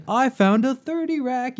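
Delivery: high, eager discovery; thrilled little announcement; excited find. Retold or imitated. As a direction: show off the bottle you just found. Party bus find.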